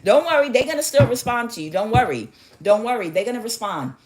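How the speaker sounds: high, fast coach patter; hammed-up quick placating; mock reassurance. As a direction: rattle off comfort in a put-on voice. Mimics coaches' empty reassurances.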